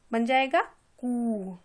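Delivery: ordinary delivery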